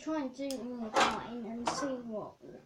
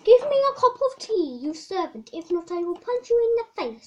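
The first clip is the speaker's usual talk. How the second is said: loud high posh royal; hammed-up bossy queen; 4 semitones higher, 8 dB louder than usual. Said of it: "Jack's gone back to his posh voice".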